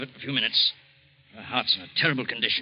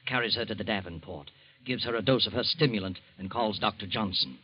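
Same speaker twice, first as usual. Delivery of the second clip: steady narrator voice, softer toward the end; radio narration; 5 semitones lower, 4 dB quieter than usual. A narrator's reading. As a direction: report the rescue calmly. Narrator describes the scene.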